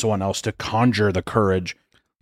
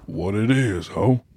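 a hint of a put-on street voice for the line; 2 semitones lower, much slower than usual. Shown as a demonstration. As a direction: lean into a cool greeting, lightly done. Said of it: "No, no, your regular voice".

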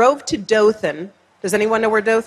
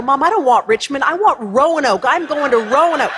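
excited; 6 semitones higher than usual. Retold or imitated. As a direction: gush the news to mom. Excitedly tells mom on phone.

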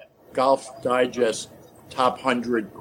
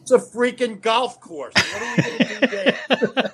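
high, disbelieving retort; incredulous; 10 semitones higher than usual. Retold or imitated. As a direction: scoff at the idea, pitched way up. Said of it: "I went".

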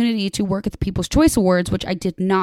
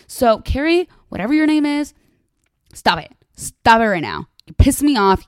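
scolding; 5 semitones higher, 3 dB louder than usual. Real, not acted. Scolding a reality star.

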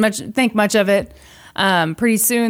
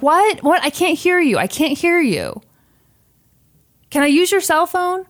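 mock pleading stranger; 9 semitones higher than usual. Stranger faking deafness.

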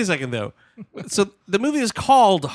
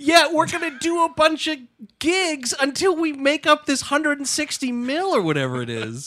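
mock crew voice; 10 semitones higher than usual. Voicing the crew's plan.

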